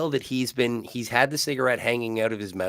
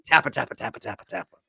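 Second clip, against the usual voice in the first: typing noise; 2 semitones higher, faster than usual. Retold or imitated. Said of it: "he's just like".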